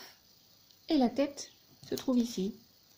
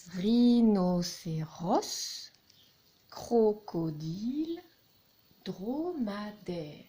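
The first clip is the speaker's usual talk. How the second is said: "rhinocéros", "crocodile", "dromadaire" slowly stretched, syllables joined; slow, linked syllables on "rhinocéros", "crocodile", "dromadaire"; much slower than usual. Shown as a demonstration. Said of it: "Quatre syllabes pour lui".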